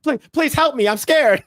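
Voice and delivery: hammed-up panicked employee; mock scared plea. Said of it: "He's like".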